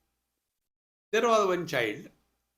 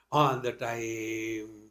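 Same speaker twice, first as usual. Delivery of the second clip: ordinary delivery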